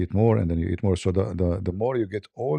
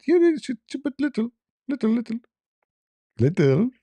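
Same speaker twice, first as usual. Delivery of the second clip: high, fast mock reassurance, little little; mock doctor reassuring. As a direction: mimic him brushing it off, pitched up.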